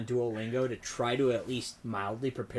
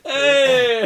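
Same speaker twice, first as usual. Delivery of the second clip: loud, high drawn-out holler; mock Italian cheer; 11 semitones higher, 12 dB louder than usual. Co-host imitating very animated Italian fans, big holler. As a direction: yell it out like an animated crowd.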